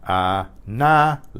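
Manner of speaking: Análisis broken into syllables, ah-NA-lee-sees; syllable-by-syllable demo of Análisis, NA strongest; stress on second A. Shown as a demonstration. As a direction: separate each syllable of Análisis and lift NA. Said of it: "accent on the second A".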